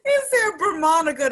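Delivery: high, loud, laughing cry; helpless laughter. Real, not acted. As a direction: repeat it through real laughter. Still laughing at the slip.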